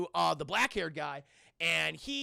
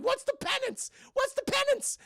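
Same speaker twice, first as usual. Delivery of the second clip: squeaky, very high frantic question; hammed-up high-pitched reply; mock alarm; 4 dB louder than usual. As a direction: ask it in a panicked falsetto. Dipper's alarmed reply to demon.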